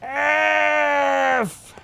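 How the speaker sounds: long held high shout. Real, not acted. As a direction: wail the letter out. Game death cry.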